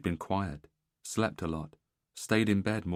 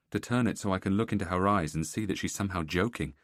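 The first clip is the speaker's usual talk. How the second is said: usual speaking voice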